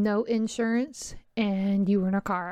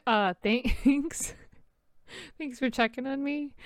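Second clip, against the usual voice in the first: laughing thanks; 4 semitones higher, slower than usual. Real, not acted. Wry reply to son's remark.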